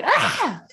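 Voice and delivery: sudden high yell, imitating a scream; primal scream. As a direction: let out a short mock scream. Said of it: "primal screaming like that".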